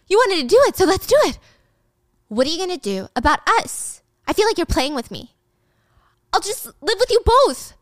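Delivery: put-on high, pleading girlfriend voice; Stacy voiced. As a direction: raise the voice high as a pleading young woman. Stacy voiced pushing back.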